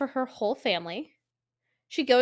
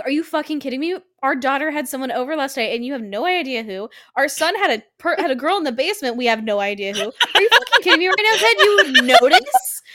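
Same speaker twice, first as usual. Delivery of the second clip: imagined furious rant; 3 semitones higher, 6 dB louder, faster than usual. Retold or imitated. Karen ranting at husband Ted, fast furious mock outburst. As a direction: explode at your useless husband. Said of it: "You know she's like".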